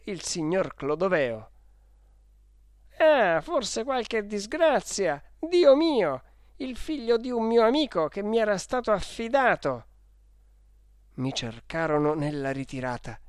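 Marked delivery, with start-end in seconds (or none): 3.0-9.9 alarmed lament. Acted. Guardian fearing a mishap to friend's son, alarmed. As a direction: wail in alarm for the missing child. Guardian fears the boy came to harm.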